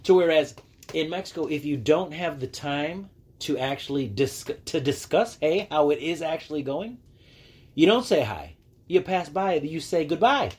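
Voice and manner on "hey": ordinary delivery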